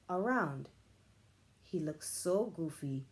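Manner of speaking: ordinary delivery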